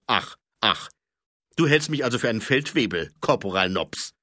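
loud, fast pompous indignation; captain Colon needling Nobby; mock offended. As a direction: play the wounded superior.

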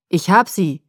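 loud, booming shout; booming call. A narrator's reading. Rescuer announcing to the search group, booming call. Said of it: "rief er dröhnend".

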